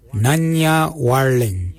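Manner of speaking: "Nanya warling" said slowly for learners; slow repeat. Shown as a demonstration. Language teacher repeats phrase slowly for learners.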